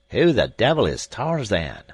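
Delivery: loud, baffled seaman's shout; rough sailor yelling a question; sailor crying out. A narrator's reading. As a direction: demand it, rattled. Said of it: "cried the sailor".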